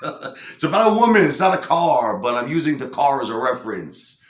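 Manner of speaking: high, loud mock protest; exasperated explaining. Singer correcting the record company about his lyric, in hammed-up exasperation. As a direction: insist on it as if misunderstood.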